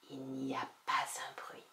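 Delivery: hushed narrator whisper. A narrator's reading. Narrator in the silent attic, whispering. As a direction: breathe it into the silence.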